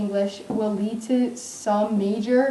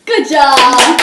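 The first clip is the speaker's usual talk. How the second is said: cheerful praise; 3 semitones higher, 12 dB louder than usual. Real, not acted. Teacher praising the class.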